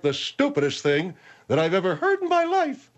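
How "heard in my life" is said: cartoon character voice. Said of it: "when you sound like a cartoon character".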